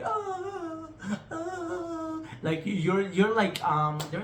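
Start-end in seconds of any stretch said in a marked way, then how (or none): 0.0-2.2 high strained mock sobs, imitating crying so hard; imitated sobbing cry. Telling viewers how meditation crying sounds, high mock sobbing. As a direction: voice sobbing gasps, half-acted. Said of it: "and you're like".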